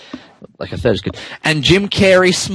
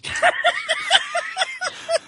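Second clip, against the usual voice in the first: high squealing laugh; 9 dB quieter than usual. Real, not acted. Narrator bursts out laughing.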